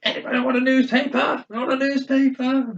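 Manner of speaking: high put-on voice of an old man calling down the carriage; imitating old man. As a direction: sing it out like a batty old man offering his paper. Retelling old man offering newspaper.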